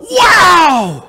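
high, amazed "Wow!"; a preacher showing awe. Shown as a demonstration. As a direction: gasp out "Wow!" in wonder. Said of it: "the root of the word is to be amazed".

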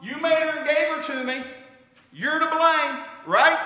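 loud, high, indignant excuse; defensive blame. Acted. Adam protesting loudly to God, defensive and indignant. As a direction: shove the blame back at God.

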